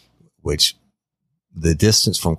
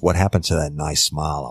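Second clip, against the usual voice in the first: usual speaking voice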